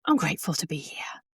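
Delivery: soft whispered words to herself; whispered gratitude. Shown as a demonstration. Coach shows riders a quiet thank-you, whispered. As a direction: whisper it as you sit in the car. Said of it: "take a breath".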